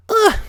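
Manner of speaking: high frustrated groan of ugh; pony's fed-up ugh. A narrator's reading. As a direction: let out an exasperated ugh. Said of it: "Twilight cried in frustration".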